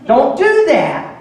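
high, loud urgent call across the playground; loud, high warning shout to a small son. Retold or imitated. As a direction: call out to a child climbing too high. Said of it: "I remember calling out".

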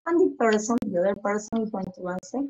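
ordinary talk

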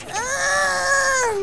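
high, pained shout imitating a friend; imitated cry. Retelling Suleiman's cry to his friend, a high pained shout. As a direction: yelp like Suleiman stuck at the door. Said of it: "He's like".

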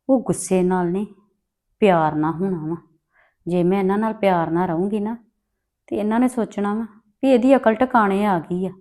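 low, soft plotting to herself; scheming aside. Acted. Daughter-in-law alone, plotting against the family, in a quiet scheming murmur. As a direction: murmur the plan quietly, for no one else to hear.

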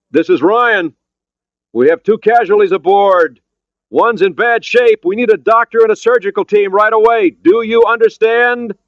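high, loud call across the water; urgent shout. Acted. Ryan shouting to a boat for a doctor, urgent shout. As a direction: shout each phrase out to be understood.

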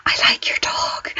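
soft whispered compliment; whispering to a passing dog owner. Retold or imitated. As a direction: whisper it behind their back. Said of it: "I whisper behind them".

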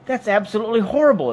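high, loud outrage; loud, high-pitched horrified protest; appalled exclamation. A narrator's reading. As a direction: burst out in revulsion. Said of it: "exclaimed Arthur".

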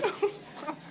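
high squealing laughter; laughing while squirming away; being tickled. Real, not acted. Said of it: "I'm ticklish".

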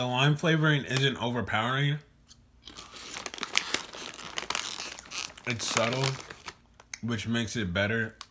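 chewing between words; talking while eating; mouth full of chips. Real, not acted. Seen 1: Bites chips at 1.8–2.5 and 8.3–9.1 while speaking.

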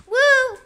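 short high, loud "woo"; excited whoop. Pretend. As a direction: whoop as you jump. Shows off a jump.

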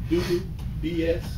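ordinary talk

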